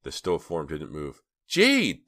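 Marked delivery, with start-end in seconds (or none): 1.4-2.1 high, loud scream of the name; girl screams her friend's name. A narrator's reading. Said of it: "This time Dina screamed it".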